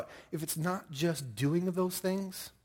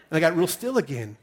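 usual speaking voice

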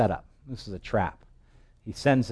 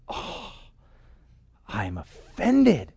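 mock offended; much slower than usual. Naaman takes offense at the order.

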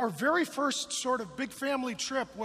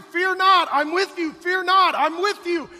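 booming preacher proclamation, each line a cry; loud, high, fervent preacher shouting; preaching at full force; 8 semitones higher, 15 dB louder than usual. Acted. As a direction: thunder the promise over the crowd. Preacher proclaiming God's words.

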